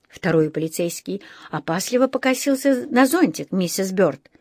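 ordinary delivery, nothing marked

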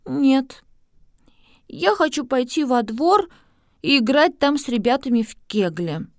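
put-on high, resolute girl voice; sigh, then insist like a child; stubborn little girl. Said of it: "с глубоким вздохом произнесла".

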